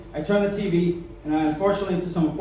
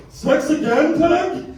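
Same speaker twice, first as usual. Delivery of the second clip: higher, louder sitcom-character impression; Al Bundy; 10 semitones higher, 6 dB louder, much slower than usual. Comic quoting a nineties sitcom, high loud impression. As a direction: exclaim it like an incredulous sitcom husband. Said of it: "Al Bundy".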